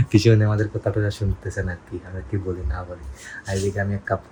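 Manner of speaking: low, very soft aside to viewers; hushed voice. Real, not acted. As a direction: keep your voice right down. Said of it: "আমি আস্তে আস্তে কথা বলতেছি". (চাপা, নিচু গলায়)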